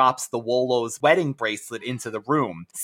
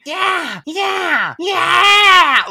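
high shrieking cat yells; screaming cat; 6 dB louder, much slower than usual. Retold or imitated. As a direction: screech it like a cat. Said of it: "voices literally sounded like a cat screaming".

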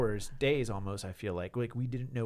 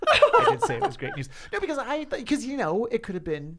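laughing protest, high and loud; 11 semitones higher, 11 dB louder than usual. Real, not acted. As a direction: protest while cracking up. Laughing while denying teasing.

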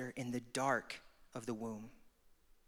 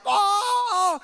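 mock stadium shout, much higher and louder; hammed-up crowd "Oh!"; crowd roar; 14 dB louder than usual. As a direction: yell like fight fans going wild. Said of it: "they're like".

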